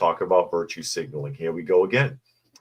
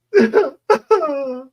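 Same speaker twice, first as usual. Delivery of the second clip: hammed-up mock wail; 8 dB louder than usual. Mock sob after quote.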